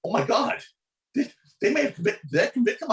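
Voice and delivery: high, rising panic; panicked thought. Retold or imitated. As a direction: voice jumping up in alarm. Panicked inner thought at verdict.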